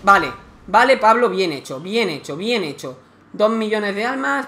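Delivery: loud, happy praise for a good move; excited cheer. Real, not acted. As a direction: cheer yourself on after a win. Celebrating a win in game.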